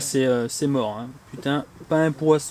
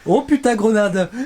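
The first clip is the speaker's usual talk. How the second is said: high, loud laughing yell; grenade warning yell; 7 semitones higher, 4 dB louder, slower than usual. Acted. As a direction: shout grenade as a joke. (cri d'alerte grenade en riant)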